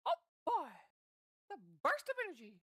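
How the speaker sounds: a hint of the high Mickey squeak; half-hearted Mickey try; lightly done Mickey Mouse. Shown as a demonstration. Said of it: "then you can do, like".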